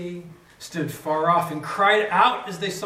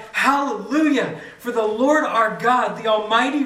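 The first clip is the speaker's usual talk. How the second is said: higher, louder ringing Hallelujah; cried hallelujah; 5 semitones higher, 6 dB louder than usual. Acted. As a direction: lift it like a crowd's shout of praise. Multitude like thunder crying out.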